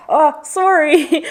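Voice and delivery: imperfect New Zealand accent imitation. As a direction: draw it out like an apologetic New Zealander. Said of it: "can't even imitate it properly".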